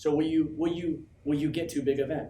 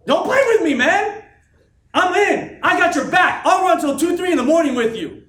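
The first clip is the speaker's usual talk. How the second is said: a much higher, louder shout of loyalty; 14 semitones higher, 13 dB louder than usual. Real, not acted. Speaker to his team, shouting that he is all in. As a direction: bellow your promise to them.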